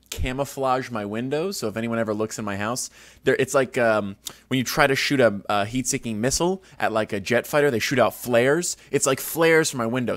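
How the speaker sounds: ordinary talk, nothing marked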